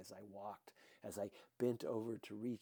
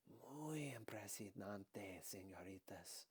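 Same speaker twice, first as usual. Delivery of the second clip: slow, hushed whisper of amazement; awed whisper; 4 semitones lower, 9 dB quieter, slower than usual. Acted. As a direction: breathe it out in disbelief. Awed reaction to escaping ticket.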